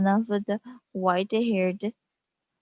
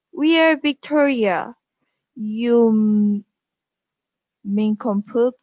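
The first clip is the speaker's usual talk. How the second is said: loud, affronted royal outburst; imperious queen; 3 semitones higher, 7 dB louder than usual. A narrator's reading. Queen Victoria scolds the medium who asked which queen, imperious. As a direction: proclaim it loudly, with royal scorn.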